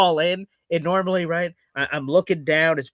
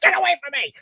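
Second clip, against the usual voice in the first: a higher, gruff get-away; mock grumpy brush-off; 13 semitones higher, much faster than usual. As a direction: snap at the family, hammed up. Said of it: "I'm like".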